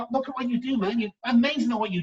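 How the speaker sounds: enthusiastic praise. Retold or imitated. Voiced praise quote.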